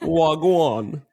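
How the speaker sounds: put-on playful Jamaican accent greeting. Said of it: "I kept talking in a Jamaican accent".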